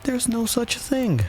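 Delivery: very high, slow indignant protest; angry. A narrator's reading. Alice disputing the treacle well, angry. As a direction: contradict high and drawn out, without volume. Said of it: "Alice was beginning very angrily".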